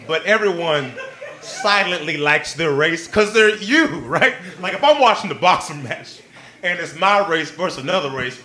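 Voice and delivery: usual speaking voice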